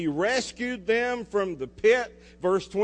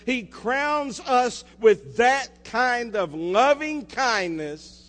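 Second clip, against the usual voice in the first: raised, driving sermon voice; fervent preaching; 4 semitones higher, 6 dB louder than usual. Acted. Pastor preaching on healing to the church, fervent and raised. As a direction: preach it out to the congregation.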